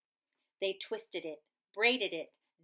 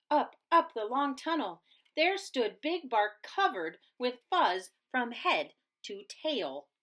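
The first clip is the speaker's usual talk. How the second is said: ordinary delivery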